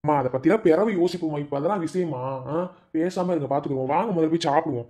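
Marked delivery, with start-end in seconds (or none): none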